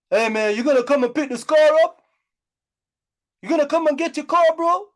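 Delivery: imitating a desperate guy calling; mock pleading caller. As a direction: put on the voice of a needy caller. Imitating a caller begging about car.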